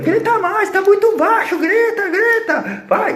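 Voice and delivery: a high, piercing full-voice yell; shouting. Retold or imitated. At the nursing home, shouting at a screaming old lady to match her. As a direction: scream it over someone's screaming.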